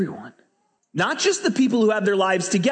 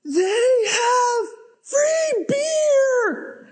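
very high, drawn-out holler; mock ecstatic shout, stretched and high; imitated excited yell; much slower than usual. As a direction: holler it slowly across a busy street. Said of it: "and the guy yells".

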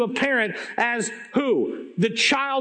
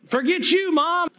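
put-on bratty little girl, pitched well up; defiant kid; 9 semitones higher than usual. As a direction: sass your mom back like a stubborn kid. Defiant kid talking back to mom.

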